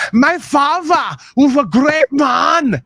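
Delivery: slow, high mock German accent. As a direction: ham up a German son praising his father. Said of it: "you need to do that German voice more".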